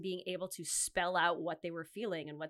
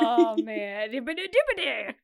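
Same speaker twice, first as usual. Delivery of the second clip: silly movie voice; 2 semitones higher, 10 dB louder than usual. Retold or imitated. Imitating a movie catchphrase.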